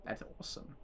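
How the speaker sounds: breathless deadpan whisper; sarcastic whisper under the breath; whispered aside. Real, not acted. Reacting to a murder plot in a whisper.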